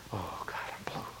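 breathy whisper of self-pity; whispering as if wallowing in hurt. Acted. Preacher voicing a hurt listener, whispered. Hurt person feeling sorry for themselves.

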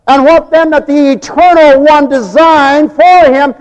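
loud, high, slow sermon cry; full-force preaching. Acted. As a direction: thunder it from the pulpit.

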